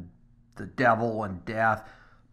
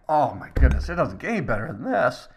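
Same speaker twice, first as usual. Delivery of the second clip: delighted; 3 semitones higher, 6 dB louder than usual. Retold or imitated. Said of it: "I'm like".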